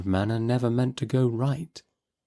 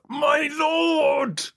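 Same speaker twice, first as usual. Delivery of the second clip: high shouted plea; remonstrative cry. A narrator's reading. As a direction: Shropshire man protesting as the judge leaves. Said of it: "ventures another remonstrative".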